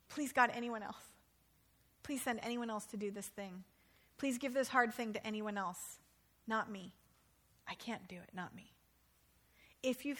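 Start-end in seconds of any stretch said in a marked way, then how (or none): 0.1-8.7 soft, fading plea; a believer quietly praying to be spared; quiet pleading prayer. Acted. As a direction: beg in a softer voice, trailing away.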